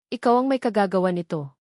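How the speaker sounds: ordinary delivery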